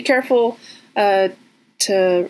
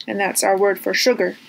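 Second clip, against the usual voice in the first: ordinary delivery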